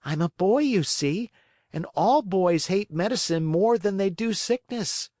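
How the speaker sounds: put-on sulky little wooden boy refusing; whiny puppet boy. Pinocchio whining to the fairy, high and petulant.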